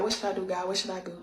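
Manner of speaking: retold prayer whispered to herself, softly; hushed desperate prayer. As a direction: murmur it very softly, over and over. Retelling her desperate repeated praying.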